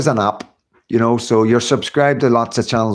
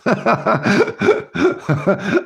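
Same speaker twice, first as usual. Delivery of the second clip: high laugh; 9 semitones higher than usual. Real, not acted. Laughing at own dog boast.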